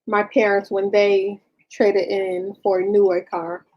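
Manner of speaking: halting dictation, speaking the punctuation aloud; dictating. Real, not acted. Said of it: "hear me dictating".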